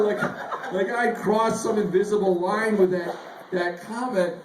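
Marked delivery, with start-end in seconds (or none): none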